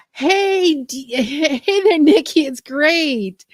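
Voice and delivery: loud, high, delighted hello; bright burst of welcome; excited greeting. Real, not acted. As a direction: greet a friend who just walked in, thrilled. Greeting a viewer arriving.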